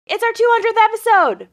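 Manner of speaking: high, fast, excited announcement. Real, not acted. Restarted announcement, more excited.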